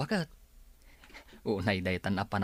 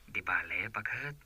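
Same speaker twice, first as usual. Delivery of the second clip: whisper; 6 dB quieter than usual. Acted. Husband softly dismisses wife.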